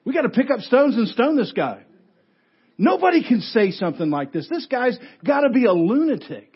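rally an outraged mob against him; acted anger. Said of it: "you can tell how angry they are".

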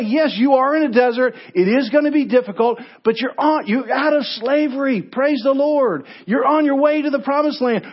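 rally the congregation with each praise the Lord; full-force preaching. Acted.

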